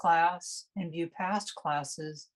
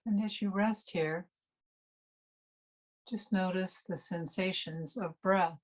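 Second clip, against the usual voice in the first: guided meditation. Real, not acted. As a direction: ease listeners unhurriedly into resting and noticing the breath.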